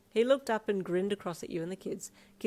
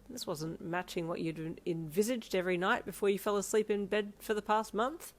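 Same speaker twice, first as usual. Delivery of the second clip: plain reading voice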